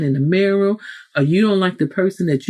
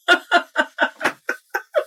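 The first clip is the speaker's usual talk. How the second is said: breathy high laugh; 12 semitones higher than usual. Real, not acted. Laughs at own example.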